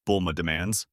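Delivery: ordinary delivery, nothing marked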